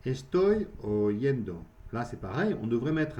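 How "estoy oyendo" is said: slow model phrase. Shown as a demonstration. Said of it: "Oír".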